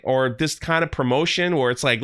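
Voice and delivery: normal talking voice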